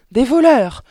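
high, loud, choked alarm; strangled cry. A narrator's reading. Mother fearing burglars, strangled loud cry. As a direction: cry out with the throat tightening in fear. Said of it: "d'une voix étranglée".

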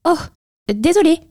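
high-pitched little mouse saying sorry; quick apology in a high squeaky mouse voice; apologetic mouse. A narrator's reading. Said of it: "s'excusa la souris".

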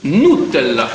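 "Nutella" as noo-TEL-la, low pitched; low-voiced "Nutella" with an oo first syllable; echoed pronunciation. Shown as a demonstration. Repeating the friend's correction, low "Nutella" with oo. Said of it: "Non, on dit Nutella".

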